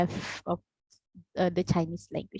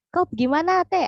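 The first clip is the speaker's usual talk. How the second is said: a brisk, high, louder Sarawak Malay demand; harsh Sarawak Malay; 9 semitones higher, 5 dB louder, faster than usual. Retold or imitated. Sarawak speaker asking where you are going, harsh Sarawak Malay. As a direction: bark the question the Sarawak way, a little harsh. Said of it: "we are a little bit harsh".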